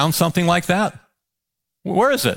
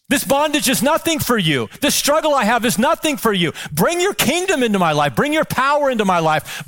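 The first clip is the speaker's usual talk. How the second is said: higher, confident declarations; bold prayer; 5 semitones higher than usual. Shown as a demonstration. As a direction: pray it higher, declaring victory. Pastor models bold personal prayer.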